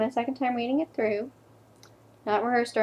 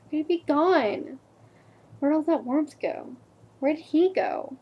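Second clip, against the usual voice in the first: higher-pitched, acted grief; anguished questioning; 3 semitones higher than usual. Girlfriend asking where he went, anguished disbelief. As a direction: plead for an answer in disbelief. Grieving questions about dead boyfriend.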